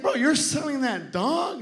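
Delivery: high-pitched, amazed question; acted wonder, voice lifted high; mesmerized. Guy walks back to dog's seller, mesmerized. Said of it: "like, mesmerized".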